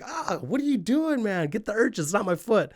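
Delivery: very high, pained protest; Siegel in pain. Retold or imitated. Siegel yelling at Raven for stabbing his foot, high and pained. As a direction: squeal it out, stretched and hurt.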